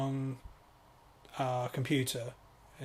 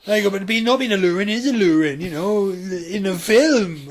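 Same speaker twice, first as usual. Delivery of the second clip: louder, higher daft character; silly put-on voice; 6 semitones higher, 9 dB louder than usual. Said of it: "why am I speaking like this".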